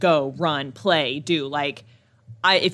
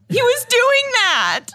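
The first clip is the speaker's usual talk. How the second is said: mock crying voice; 6 dB louder, much slower than usual. Retelling crying son.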